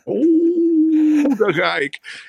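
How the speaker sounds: high excited whoop; giddy exclamation. Acted. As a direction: let out a high giddy "oeh". Excited exclamation while walking. (hoge opgewonden uitroep)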